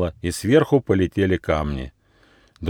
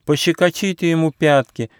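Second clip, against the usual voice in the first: wounded turtle crying out; 6 semitones higher than usual. A narrator's reading. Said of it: "крикнула раненая черепаха".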